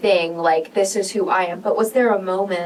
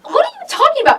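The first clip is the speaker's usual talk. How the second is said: very high, louder disbelieving cry; incredulous protest; 5 dB louder, faster than usual. Real, not acted. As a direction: shoot up in playful disbelief. Host disputes guest's self-criticism.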